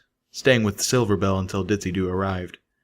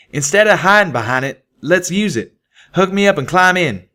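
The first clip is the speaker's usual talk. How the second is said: insistent country stallion; Calamity voice; 10 semitones higher, 6 dB louder than usual. Acted. Calamity explains plan.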